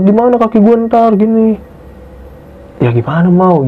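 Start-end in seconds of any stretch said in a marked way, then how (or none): 0.1-1.6 high tearful whine, mimicked; imitated bawling. Retelling Mawar worrying about the leg, imitated bawling. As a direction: imitate a friend's worried crying. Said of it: "Nangis, Bang, kejer".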